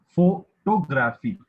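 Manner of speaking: PHOTOGRAPHY slowly broken up, loud punch on the first syllable; stress demonstration. Shown as a demonstration. Reader showing word stress with an example, photography stressed loudly on PHO. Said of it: "when and where to stress the word".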